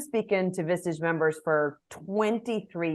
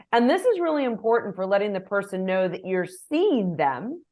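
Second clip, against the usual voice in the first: ordinary delivery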